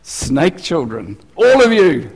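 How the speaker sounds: hammed-up fiery rebuke, rising to a shout; mock prophet shout. Preacher playing John scolding the crowd, shouting. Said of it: "I've never said anything like that".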